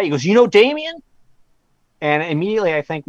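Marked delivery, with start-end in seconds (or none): 0.2-1.0 put-on louder, higher Southern-accented question. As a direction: imitate a Southern caller asking eagerly. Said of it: "like, Southern accent".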